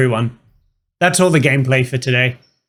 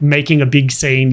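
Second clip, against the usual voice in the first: ordinary talk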